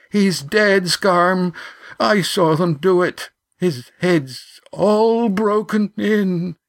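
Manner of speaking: high, drawn-out tearful wail; sobbing. A narrator's reading. Yan tells Scarm of the death, sobbing. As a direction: cry it out, stretching the last words. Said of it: "Yan sobbed".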